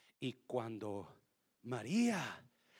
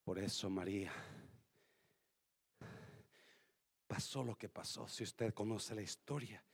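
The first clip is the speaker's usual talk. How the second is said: much softer, tender sermon voice; soft preaching; 8 semitones lower, 8 dB quieter than usual. Acted. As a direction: draw the congregation in gently. Quiet reflective preaching.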